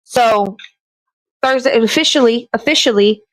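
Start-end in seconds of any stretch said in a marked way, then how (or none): none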